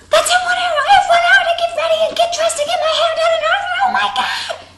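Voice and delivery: very high, loud and fast panic; frantic high shriek about one hour left; panicked shriek. Retold or imitated. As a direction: rattle off the list in a high squealing rush. Girl panics about getting ready.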